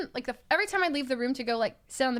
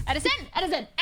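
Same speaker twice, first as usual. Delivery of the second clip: raised-pitch urgent name-calling; urgent warning; 4 semitones higher, much faster than usual. Retold or imitated. Retold warning to partner about to puke, urgent. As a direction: call his name to cut through, retold. Warning him as he nears vomiting.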